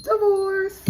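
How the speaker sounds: a high, loud, final single word; mock declaration. Reader acting out the fed-up wife announcing it, high and loud. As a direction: slam the word down like a verdict. Acted wife's blunt reply.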